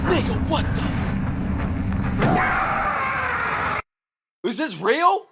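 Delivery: high, loud stunned outcry; shocked outburst. Real, not acted. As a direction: blurt it out in real shock. Shocked by video clip.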